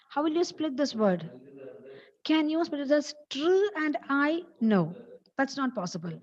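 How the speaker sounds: normal talking voice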